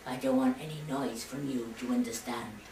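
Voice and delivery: giant father voice. Acted. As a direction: growl a quiet threat at the boy. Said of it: "And then he said".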